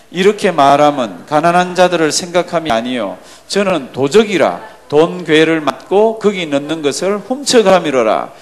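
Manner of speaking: loud, slow, measured Bible recitation; scripture reading aloud. Acted. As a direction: lead the congregation through the verse, strong and drawn out. Leads congregational scripture reading. (크고 느리게 또박또박 성경 봉독)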